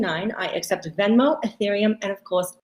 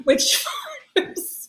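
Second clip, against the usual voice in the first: high drawn-out word; 4 dB quieter, much slower than usual. Acted.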